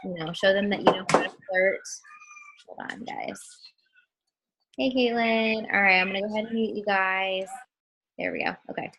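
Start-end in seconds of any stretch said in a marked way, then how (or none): 2.7-3.4 whispered aside. Real, not acted. Aside while muting viewers.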